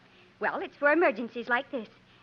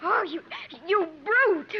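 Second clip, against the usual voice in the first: higher, flustered outrage; indignant; 4 semitones higher, slower than usual. Acted. Sandal to Jim after he disarms her, indignant. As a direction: spit out the insult in a huff.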